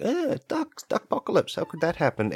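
very high mock-alarmed voice, easing down; imitated baffled humans. Humans with earbuds hear duck apocalypse, mock bewilderment. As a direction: mimic silly listeners in a squeaky pitch.

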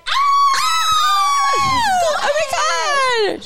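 high, slow shrieking celebration; mock victory scream. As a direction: scream it like an overexcited team. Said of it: "we need to be like".

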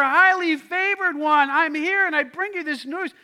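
high, loud heavenly proclamation; angelic warrior. Acted. Angel warrior appears to Mary with news, loud proclaiming voice. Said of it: "this angelic warrior appears to her".

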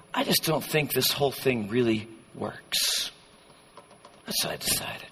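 low, soft, resigned confession; quietly disillusioned. Acted. Preacher playing doubter who decided faith fails, quiet resignation. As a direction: drop to a near-mutter, giving up. Acting a believer giving up on faith.

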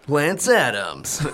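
high, slow, upbeat trailer announcer; happy rom-com trailer voice. Shown as a demonstration. Co-host pitches a comedy film, happy trailer voice. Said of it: "rom-com trailer voice".

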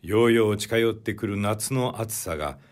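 usual speaking voice

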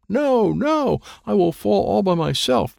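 put-on high, frightened child's cry; panicked protest. A narrator's reading. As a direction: plead in rising panic. Child panics about falling alone.

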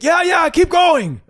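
very high, slow, loud holler; shouting back. Retold or imitated. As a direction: imitate a friend calling from far away. Friend shouting back from down the dune.

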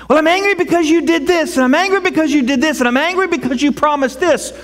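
imitating an upset person listing grievances; acted angry complaint. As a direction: rattle off the complaints to God. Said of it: "If you're feeling angry at God".

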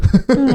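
a quick giggle; short high laugh. Real, not acted. Describing the girl at the door, a quick giggle. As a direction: giggle at your own words. Laugh after "una ángel.

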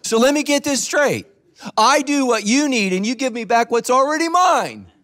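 a hint of dry sarcasm from God; lightly voiced sarcastic God; God's sarcastic reply. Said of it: "And God's like".

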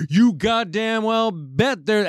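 high, drawn-out hillbilly holler; hillbilly accent. Retold or imitated. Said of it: "he's got this fucking hick hillbilly accent".

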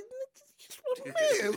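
high, loud, cracking squeal of recognition; excited disbelief. Retold or imitated. Telling a friend he met Raider Ruckus, squealing in disbelief. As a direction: shriek it like you can't believe who it is.

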